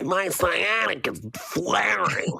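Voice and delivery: whiny voice. Pretend. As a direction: complain like a needy client. Mock whiny massage client.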